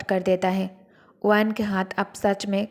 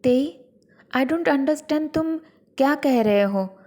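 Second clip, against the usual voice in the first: higher-pitched innocent puzzlement; mildly confused, innocent; 5 semitones higher, slower than usual. A narrator's reading. Wife feigns not understanding her angry partner, mildly confused and innocent. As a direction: act lightly baffled, as if nothing makes sense. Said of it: "हल्का सा confused और innocent बनकर कहती हैं".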